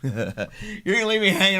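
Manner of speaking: laughing. Real, not acted. Laughing at son's silence.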